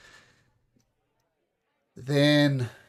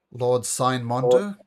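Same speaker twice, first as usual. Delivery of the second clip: ordinary talk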